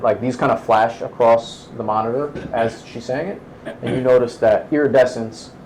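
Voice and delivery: normal talking voice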